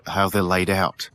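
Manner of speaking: ordinary delivery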